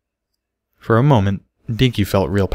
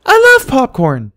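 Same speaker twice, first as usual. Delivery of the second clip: put-on very high, slow, loud little girl cry; high squealing delight; thrilled filly; 7 dB louder, slower than usual. A narrator's reading. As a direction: gush it like a delighted child. Excited filly voiced in story.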